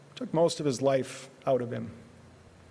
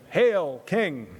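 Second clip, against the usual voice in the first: mock hail to a king, a touch louder; sarcastic; 3 semitones higher, 3 dB louder than usual. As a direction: sneer it like a mocking soldier. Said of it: "they sarcastically shouted".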